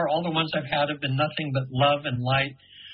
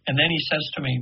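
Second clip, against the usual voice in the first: ordinary talk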